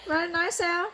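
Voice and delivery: normal talking voice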